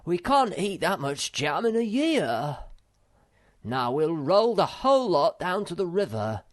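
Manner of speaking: a small troll taking charge of the pears; Moomin Troll voice. A narrator's reading.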